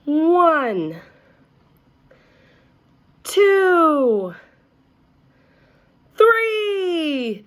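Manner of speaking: high, loud, drawn-out count; excited countdown. A narrator's reading. Teacher counting down for the class, high and loud. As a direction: build the count to a burst.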